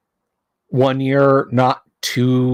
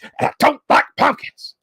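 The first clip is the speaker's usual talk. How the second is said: squeaky rant peak; 11 semitones higher than usual. Retold or imitated. Ranting impression voice leaps up high. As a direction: jump up high on pumpkins. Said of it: "as read by Alex Jones".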